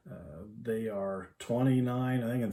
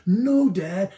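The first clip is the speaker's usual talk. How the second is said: put-on exasperated little girl; 7 semitones higher, 8 dB louder than usual.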